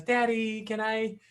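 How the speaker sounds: high, lightly voiced pleading kid; pleading child. Kid begging dad with big eyes, high and pleading. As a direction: ask sweetly like a child. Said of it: "they'll blink their eyes".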